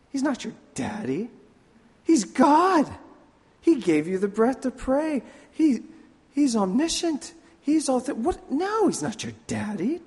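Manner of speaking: high, loud mock outrage at a prayer; hammed-up indignant protest, pitched high; indignant rant. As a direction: rail against calling God daddy. Preacher mocks his angry past self.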